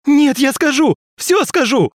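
high, loud desperate pleading; panicked pleading. A narrator's reading. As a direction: beg in a panic.

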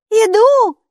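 put-on high, loud girl's call. A narrator's reading. Girl calling up to her father on the balcony. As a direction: shout up to dad. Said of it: "откликнулась Майки".

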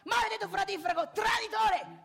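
loud, high outburst of outrage; invettiva furiosa. Acted. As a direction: yell the insult like a betrayed man. Insulto teatrale al cognato, gridato. (urlo indignato, acuto e forte)

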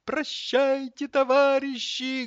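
high, slow mock-tragic wail; sorrowful farewell. A narrator's reading. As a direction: bid farewell like a doomed cutlet. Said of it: "горестно вздохнула котлета". (горестно, высоко и протяжно)

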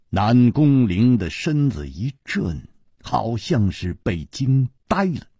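usual speaking voice

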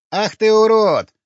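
loud, high, drawn-out shriek; angry squeal. A narrator's reading. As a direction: squeal in outrage. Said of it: "взвизгнул карлик". (злобный визг)

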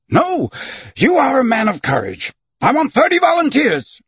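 fired up, rallying his men; overcome with emotion. A narrator's reading. Captain calling his soldiers, emotional rallying cry. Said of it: "overcome with emotion in spite of himself".

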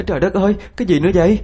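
high, fast tearful wail; crying out. A narrator's reading. Thắm drenched by a thrown drink, crying out fast and high. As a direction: burst out in quick distressed dismay. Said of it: "rồi ôm mặt khóc".

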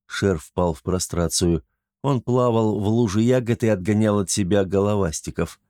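usual speaking voice

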